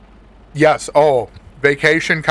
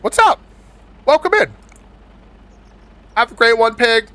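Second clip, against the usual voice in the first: excited greeting; 8 semitones higher, 3 dB louder than usual. Real, not acted.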